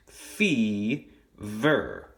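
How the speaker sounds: "fever" in two long beats, fee, ver; clapped-out syllables. Shown as a demonstration. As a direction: break "fever" slowly after fee. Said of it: "going to be two syllables".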